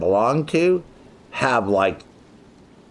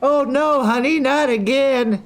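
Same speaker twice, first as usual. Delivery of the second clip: high, slow, exasperated wife voice; a weary wife sighing at her husband; mock wife; slower than usual. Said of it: "and he's like".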